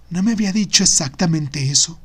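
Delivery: ordinary delivery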